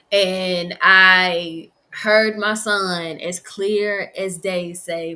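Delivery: ordinary delivery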